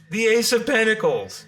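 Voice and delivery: triumphant card announcement; excited reveal. Real, not acted. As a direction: present the card with a flourish.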